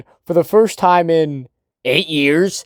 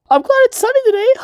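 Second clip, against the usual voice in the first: squeaky falsetto, much higher and louder; sweet girlfriend voice; 3 dB louder than usual. Retold or imitated. Mocking Chizu cheerfully chatting with Kazuya on the date. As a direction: chirp it in a high girly falsetto.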